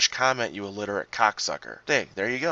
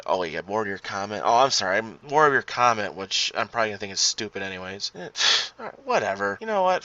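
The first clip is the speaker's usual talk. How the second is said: usual speaking voice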